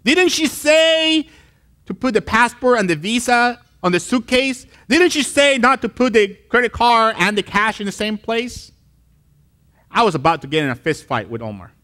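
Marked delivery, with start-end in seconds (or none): 0.0-8.7 put-on scolding older brother. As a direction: nag him like a bossy big brother. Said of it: "and he says".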